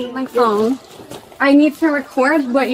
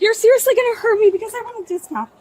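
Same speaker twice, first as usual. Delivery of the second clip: panicked pleading; 9 semitones higher than usual. Real, not acted. Woman panicking during arrest.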